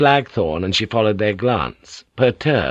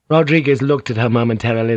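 ordinary delivery

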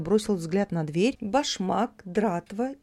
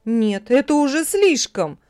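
louder, higher exasperation; indignant outburst; 5 semitones higher, 7 dB louder than usual. A narrator's reading. Hanna complaining loudly to neighbours, indignant. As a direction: appeal to the neighbours in outrage. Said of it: "обратилась Анна к своим соседкам".

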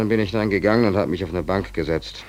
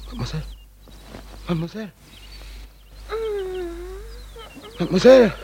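calling out; much faster than usual. Acted. Ruft die schlafende Dame.

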